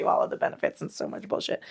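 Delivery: quick unvoiced whisper of the new slogan; slogan whispered fast as a cheeky aside. Shown as a demonstration. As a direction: hush it like a naughty joke. Mock revised slogan, whispered.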